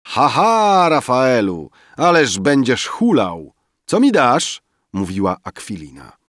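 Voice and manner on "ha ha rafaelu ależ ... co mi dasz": high, loud teasing reveler; rozbawiony okrzyk. A narrator's reading. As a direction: call out merrily over a banquet, high and loud. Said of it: "Okrzyki rozbawionego zgromadzenia". (rozbawiony, wysoki okrzyk biesiadniczki)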